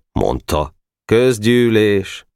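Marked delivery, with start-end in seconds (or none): 1.0-2.2 slow, drawn-out reluctant announcement; resigned, falling call to order. A narrator's reading. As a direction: announce it unwillingly, without shouting. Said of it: "Szomorúan nyugodott bele".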